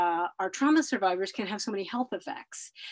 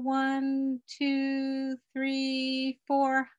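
calm counted breathing guide; slow breath counting; 3 semitones higher, much slower than usual. Shown as a demonstration. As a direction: count the breaths slowly and evenly. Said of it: "I'm just gonna try that with you guys".

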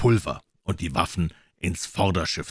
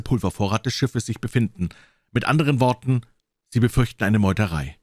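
raised, pointed character voice; lightly voiced doctor, animated and higher; emphatic doctor; 5 semitones higher, 4 dB louder than usual. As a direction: spell it out insistently, pitched higher. Doctor sums up the captain's fears.

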